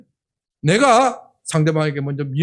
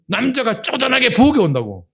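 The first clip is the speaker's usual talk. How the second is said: retold scolding; 5 semitones higher, 3 dB louder than usual. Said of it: "야단을 쳐버려요".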